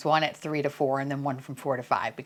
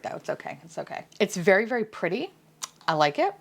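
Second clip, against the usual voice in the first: ordinary delivery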